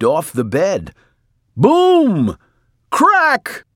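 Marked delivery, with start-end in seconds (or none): 1.6-3.6 very high, loud boom and crack; crashing noises. A narrator's reading. Narrator as Mercy hops off, explosive crash noises. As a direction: burst out with each crash.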